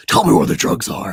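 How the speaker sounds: hoarse, unvoiced parody growl; Batman. Mocking how the hero gets his voice, rasped growl. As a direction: growl it out like a spoof superhero. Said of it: "make fun of how Batman gets his voice".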